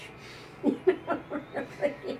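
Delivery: high, breathy laugh; amused laugh. Real, not acted. Psychic laughs after telling the lunch story, amused laugh. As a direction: let a long laugh out.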